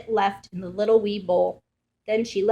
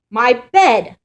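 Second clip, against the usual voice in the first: louder sputtering outburst; Papa Bear sputters at his bed; sputtered; 3 semitones higher, 9 dB louder than usual. Acted. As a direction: spit the words out in shock. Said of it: "Papa Bear sputtered".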